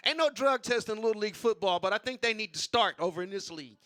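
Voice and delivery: normal talking voice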